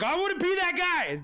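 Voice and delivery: high, excited mock exclamation; eager crowd voice. Streamer imagining viewers racing to win, excited high voice. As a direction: blurt it way up high like an eager contestant. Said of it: "'cause everyone's like".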